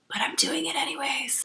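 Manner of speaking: hushed unvoiced aside; whispering. Real, not acted. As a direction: whisper it like a guilty secret. Defiant aside after admitting a habit.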